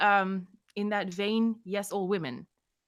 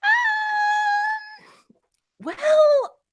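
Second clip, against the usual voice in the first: loud shout; 8 dB louder than usual. Real, not acted. Drunk outburst before answering.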